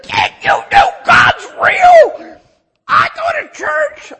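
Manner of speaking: excited shouting. Retold or imitated. Salesman shouting about God at doors. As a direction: yell it at the doorstep. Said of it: "and I said".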